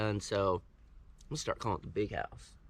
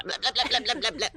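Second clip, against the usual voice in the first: high wailing ghost, laughing; 5 dB louder than usual. Pretend. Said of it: "I sound like the ghost".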